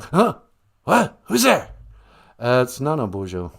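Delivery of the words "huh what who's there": put-on startled, high, confused old politician; Joe Biden. President roused mid-call by the host, startled. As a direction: jolt awake, bewildered.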